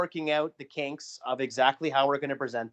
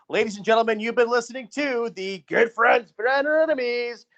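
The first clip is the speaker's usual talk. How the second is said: high, loud ring-announcer call, dragging out the show name; ring announcer outro; 9 semitones higher, 5 dB louder, slower than usual. Acted. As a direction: bellow the podcast name like a hammed-up ring announcer. Host shouts the podcast's sign-off.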